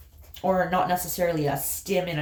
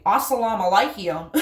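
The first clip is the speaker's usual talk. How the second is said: "Assalamu alaikum" in broad Appalachian, louder, laughing; exaggerated Appalachian accent; 5 dB louder than usual. Shown as a demonstration. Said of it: "That's an exaggeration of my accent".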